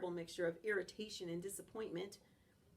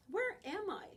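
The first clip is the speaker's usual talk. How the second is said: high, startled wake-up question; 11 semitones higher than usual. A narrator's reading. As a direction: sound suddenly lost and alarmed. Dog wakes confused.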